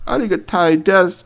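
eager bowing robot repeat; Japanese robot. Acted. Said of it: "speaking Japanese to you".

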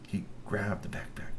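hushed, breathy whisper for suspense. A narrator's reading. Narrator whispers the theft to the child. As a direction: whisper it like a secret.

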